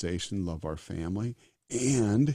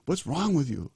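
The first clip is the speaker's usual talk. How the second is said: mock self-scolding, a little higher; a quiet hammed-up self-rebuke at night; scolding himself; 7 semitones higher than usual. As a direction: chide yourself in a hushed mock yell. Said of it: "I yell at myself quietly".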